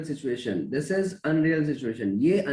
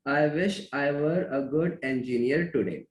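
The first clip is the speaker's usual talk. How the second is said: 1 slow dictation; 3 semitones lower, much slower than usual. Shown as a demonstration. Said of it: "आपको answer देना है".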